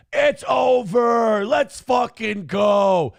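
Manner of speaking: loud, slow triumphant shout; hyped celebration. Real, not acted. Streamer celebrates a superchat, loud triumphant shout. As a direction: cheer it like a win. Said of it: "hyped reaction to superchat".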